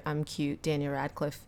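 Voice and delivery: soft mock cute laugh line; a quiet, cutesy imitation; lightly imitating Dan's signature laugh. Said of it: "doing his signature".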